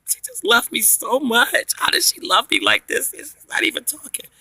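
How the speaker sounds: high, drawn-out marvelling; high-pitched adoring disbelief; gushing amazement. Retold or imitated. Gushing over baby in back seat.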